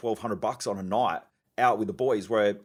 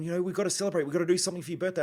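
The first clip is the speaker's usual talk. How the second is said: higher-pitched imitation of an eager wife; 7 semitones higher, much faster than usual. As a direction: mimic wife coaxing him to celebrate. Wife urging a birthday celebration.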